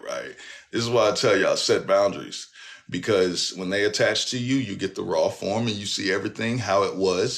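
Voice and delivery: ordinary delivery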